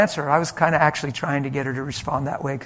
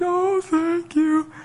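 high, drawn-out tearful wail; very slow, squeaky put-on crying; imitated bawling; much slower than usual. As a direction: gush thanks through mock sobs. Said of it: "So she's bawling".

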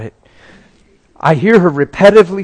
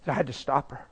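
usual speaking voice

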